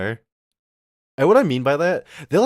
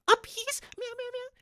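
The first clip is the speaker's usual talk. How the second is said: put-on squeaky baby talk; 9 dB quieter than usual. Girlfriend baby talk to tall girlfriend.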